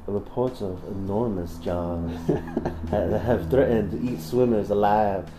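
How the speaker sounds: a light mock Southern reading, slow in places; put-on southern reading. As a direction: read the news item like a hammed-up Southern gent.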